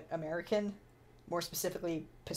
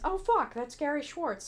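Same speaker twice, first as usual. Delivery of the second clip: shocked realization; 7 semitones higher, 4 dB louder than usual. Retold or imitated. Realizing who the celebrity is.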